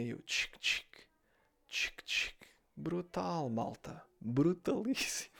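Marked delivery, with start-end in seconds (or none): 0.3-2.4 tchic tchic sussurrado do spray; spray imitado. Retold or imitated. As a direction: imita o borrifar do ambientador baixinho. (imitação sussurrada de spray)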